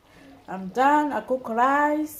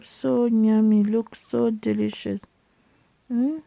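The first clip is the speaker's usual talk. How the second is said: ordinary delivery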